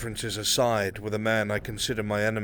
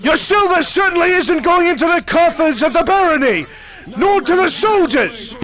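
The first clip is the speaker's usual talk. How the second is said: building from sharp questions to a full, much higher shout; furious accusation; 12 dB louder than usual. Acted. Noble berating the nobles across the table, in a rising furious shout. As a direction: rage at the idle nobles, louder with every line.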